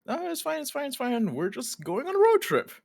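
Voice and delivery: high, loud cheery reassurance; high reassuring. Acted. Uncle soothing the sleepy twin, high and cheery. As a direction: brush off the worry brightly.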